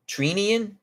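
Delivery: Treenian? with a long "ee" and an upward lift; unsure pronunciation. Real, not acted. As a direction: guess at Treenian, rising like a question. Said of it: "I don't know how to pronounce his name".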